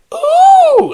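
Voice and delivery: loud, very high "ooh"; big piercing mock whoop; mock camp squeal. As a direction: shriek a drawn-out, exaggerated "ooh". Voices the majority view mockingly.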